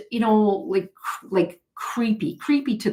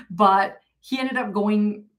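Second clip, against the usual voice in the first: normal talking voice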